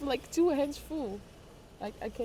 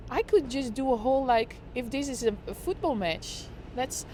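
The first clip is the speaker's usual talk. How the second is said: louder sports-commentator call of a food truck delivery; play-by-play commentary; 2 semitones higher, 3 dB louder than usual. Acted. Streamer calls a delivery like a football match, excited commentary. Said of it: "Play by play of a food truck".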